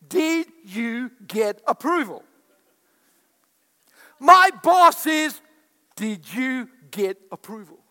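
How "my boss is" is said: high, loud exasperated grumble about the wife; hammed-up griping; loud mock complaint. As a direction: vent it like a fed-up husband.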